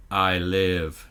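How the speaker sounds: low, eerie creature voice; creepy Proteus voice. Retold or imitated. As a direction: say it like a sinister AI. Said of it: "in the creepy Proteus voice just says".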